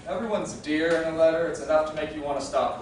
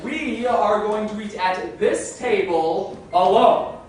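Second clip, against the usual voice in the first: loud, slow, emphatic demands; loud insistent ordering; 5 semitones higher, 9 dB louder, much slower than usual. Acted. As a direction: spell it out at full volume for a waiter who barely understands. Ordering loudly to a foreign waiter.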